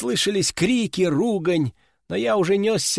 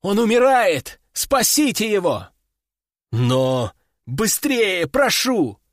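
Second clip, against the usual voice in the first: man down shout; 3 semitones higher, slower than usual. A narrator's reading. Wounded novice begs for help.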